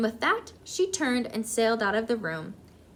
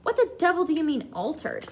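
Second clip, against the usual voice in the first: quick, higher burst that drops away; indignant shouting; 6 semitones higher than usual. A narrator's reading. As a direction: demand an explanation, mildly hammed-up outrage. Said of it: "shouted Mister Jenkins".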